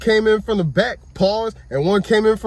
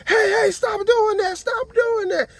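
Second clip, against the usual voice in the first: calling out; slower than usual. Retold or imitated. Bystanders calling out to stop.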